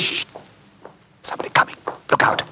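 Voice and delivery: hushed warning whisper; urgent whisper. Acted. Detective warns his friend someone is coming, urgent whisper. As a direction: whisper it to your companion.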